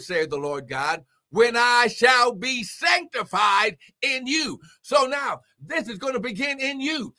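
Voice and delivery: high-pitched preaching building to a climax; fervent preaching. Real, not acted. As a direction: build to a ringing pulpit climax.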